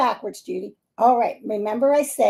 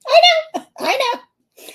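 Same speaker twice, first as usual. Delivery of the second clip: high, loud, amused cry of disbelief; 14 semitones higher, 6 dB louder than usual. Real, not acted. Psychic reacting to a viewer's pet claim, high amused disbelief. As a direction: squeal it like you can't believe it.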